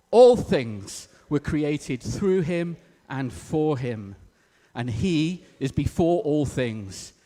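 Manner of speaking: slow, loud, phrase-by-phrase scripture reading; congregational recitation. Real, not acted. Preacher leads the congregation in repeating scripture, slow and loud. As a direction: lead a room in reciting, measured and emphatic. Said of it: "repeat this out loud all together".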